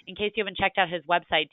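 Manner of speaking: ordinary delivery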